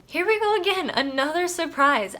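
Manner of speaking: amused surprise at the next book; excited. Real, not acted. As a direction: announce it like a fresh twist. Another unexpected book.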